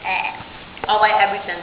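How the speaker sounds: ordinary talk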